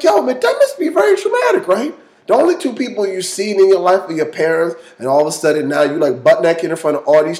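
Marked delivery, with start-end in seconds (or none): 0.0-1.9 mock shout. Speaker's own reaction, shouted.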